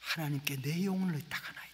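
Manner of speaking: soft breathy whisper, barely voiced; hushed whisper of surrender; whispered dying prayer.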